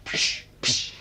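tonloses Zischen zum Verscheuchen; Tauben verscheuchen. Acted. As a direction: zischt die Tauben weg. (tonlos zischend verscheuchen)

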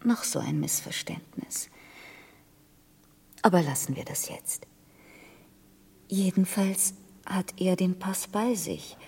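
hushed confiding whisper. Acted. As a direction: whisper as if sharing a secret. Woman confides her story secretly. (geflüstert)

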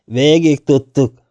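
loud, good-natured grumble; friendly growl. A narrator's reading. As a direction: growl it amiably, drawing it out. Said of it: "morogta barátságosan".

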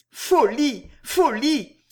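dénégation aiguë, chaque mot retombant; balbutiement effrayé. A narrator's reading. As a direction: refuse d'y croire, voix haute. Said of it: "balbutia Jeanne". (exclamation d'effroi jouée dans l'aigu)

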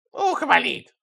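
high put-on whimper; plaintive whine. A narrator's reading. Old spy complains after being hit, whining. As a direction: complain tearfully in an acted whine. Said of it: "пожаловался шпион".

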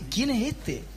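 awed hushed question. Acted. Awed disciples.

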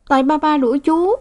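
put-on high little girl's sulky sigh; sighing child. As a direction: sigh it like a hurt girl. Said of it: "thở dài". (bé gái thở dài hờn dỗi)

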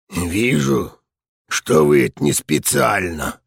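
put-on old man's raspy voice. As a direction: rasp it out like an old soldier. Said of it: "хриплым голосом сказал мужчина".

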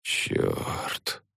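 breathless whispered curse. A narrator's reading. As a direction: breathe the curse out, barely voiced. Quiet curse after loss.

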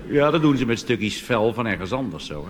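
normal talking voice

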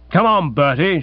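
usual speaking voice

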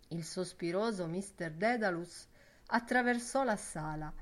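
plain reading voice

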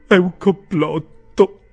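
high, loud, drawn-out outburst; finding his voice. A narrator's reading. Pickwick finally regains his speech, in a loud stunned outburst. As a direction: burst out slowly after stunned silence. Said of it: "riacquistando alla fine la parola".

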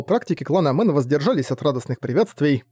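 usual speaking voice

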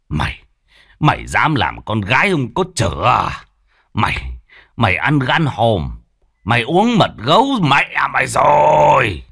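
father confronting daughter's lover, rising to a shout; furious father. Acted. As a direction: rage at the young man who shamed the family. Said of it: "ông Phú nổi giận".

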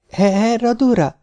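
high, stammering gasp; breathless, stumbling on the name; gasping in terror. Acted. (rémült, zihálva)

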